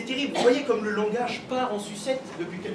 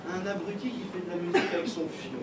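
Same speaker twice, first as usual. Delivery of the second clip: usual speaking voice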